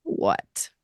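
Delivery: low flat cat reply; unimpressed cat. Retold or imitated. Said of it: "look at you like".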